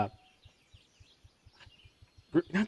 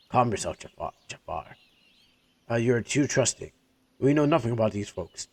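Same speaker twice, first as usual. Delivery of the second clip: a stern man warning his naive friend; suspicious caravan leader. Acted. Schneider voiced, wary leader.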